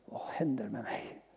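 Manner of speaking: hushed, breathless whisper; whispered realization. Retold or imitated. Realizing he has truly changed, whispered in awe. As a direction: let the shock slip out under your breath. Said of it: "då fattar jag".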